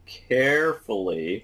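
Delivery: "carefully" stretched out slowly with emphasis; emphatic stress. Real, not acted. Instructor cautioning learners about the masking step, emphatic "carefully". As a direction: lean hard on "carefully", drawing it out. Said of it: "I emphasize carefully".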